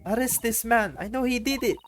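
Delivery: accusing woman voice. Acted.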